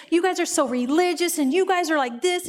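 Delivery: retold religious griping; spewing accusations. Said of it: "this people are spewing this".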